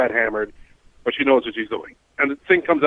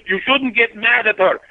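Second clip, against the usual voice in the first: imitating the drunk guy; 9 semitones higher, slower than usual. Drunk guy scolding the driver.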